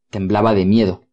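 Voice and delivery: plain reading voice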